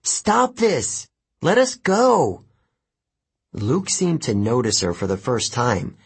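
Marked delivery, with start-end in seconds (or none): 0.0-2.4 high, pleading shout; yelled. A narrator's reading. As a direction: yell for him to stop. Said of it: "Annabeth yelled".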